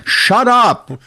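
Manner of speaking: high, stretched mock yell. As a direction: yell it as if calling out for help. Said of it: "tired of just yelling out".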